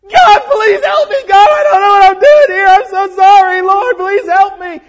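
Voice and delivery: loud, high, sobbing plea to God; desperate crying prayer. Pretend. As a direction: cry out to God in a panic, voice breaking. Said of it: "Let me tell you what would happen to me".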